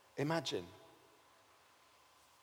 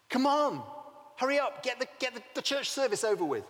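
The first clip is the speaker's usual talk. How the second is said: hurried Martha; 6 semitones higher than usual. Acted. Busy Martha before Jesus visits.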